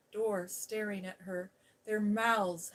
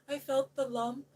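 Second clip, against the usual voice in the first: higher, louder choking panic; choked out; 6 semitones higher, 7 dB louder than usual. Acted. Colin chokes out his fear to Mary. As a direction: gasp it out in terror. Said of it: "he choked out".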